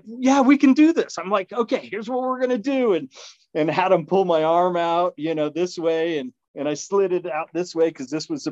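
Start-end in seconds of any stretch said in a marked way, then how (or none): 0.0-2.9 high, upbeat can-do orders; rally the paramedics; taking charge. Retold or imitated. Injured man directing the paramedics, high and upbeat.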